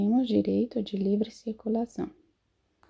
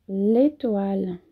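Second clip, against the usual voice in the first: slow demo; 3 dB louder, slower than usual. Shown as a demonstration. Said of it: "professora demonstra pronúncia devagar".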